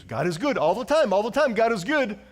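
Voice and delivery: high, loud chirpy chant; glib cliché. Retold or imitated. Pastor mocking the misused chant, high and loud. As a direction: rattle it off like a slogan. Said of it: "how we misuse".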